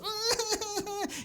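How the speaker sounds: short high burst of laughter. Real, not acted. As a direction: laugh it off lightly. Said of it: "that's why I'm laughing".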